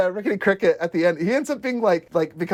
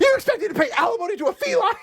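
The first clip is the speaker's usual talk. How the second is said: higher, fast indignant quote; mock outraged Dennis; faster than usual. Quoting Dennis in court to cohost, high and fast. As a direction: fire it off in disbelief.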